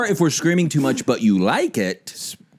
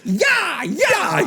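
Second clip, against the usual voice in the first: high, wild mock yell; hammed-up manic shout while spraying players; coach gone mental. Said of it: "He just totally goes mental".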